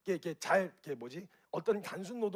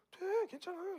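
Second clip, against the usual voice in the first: much higher, breezy acted reply; easygoing reassurance; 12 semitones higher than usual. Permissive family member allows it, high-voiced reassurance. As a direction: wave it away in a high voice.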